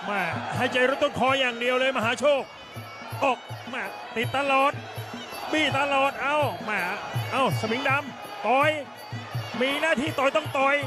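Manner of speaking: high, fast ringside play-by-play; excited boxing commentary. Acted. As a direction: call every punch like a live fight. Live Muay Thai fight calling.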